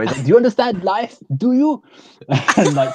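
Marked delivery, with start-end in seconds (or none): 0.2-1.8 much higher, strained mock intensity; Johnny impression. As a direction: ham up the melodramatic question. Imitating Johnny's line from film.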